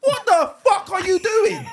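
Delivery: a hammed-up outraged yell at a stranger; furious shout. Yelling at the woman filing toenails on the train, outraged shout. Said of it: "That's what I would say".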